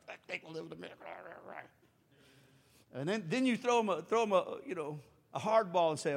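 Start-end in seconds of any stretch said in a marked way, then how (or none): 0.1-1.1 much softer, trailing mumble; barely voiced reluctant echo. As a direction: mumble it away under your breath. Reluctant repeat fading.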